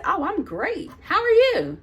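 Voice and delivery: high, singsong fake cheer; exaggeratedly chirpy retold reply; nasty nice. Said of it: "being nasty nice".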